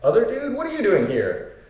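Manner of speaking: high-pitched surprised recognition; Hercules. Retold or imitated. Hercules finds two friends in Hades, surprised. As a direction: greet stuck friends in astonished high voice. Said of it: "Hercules is like".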